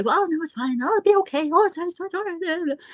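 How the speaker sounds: high, light, brushing-it-off mother voice; lightly voiced mother waving it all away; excuse-making mother. Mother talking herself out of worry, lightly mocked. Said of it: "As a mother, you say".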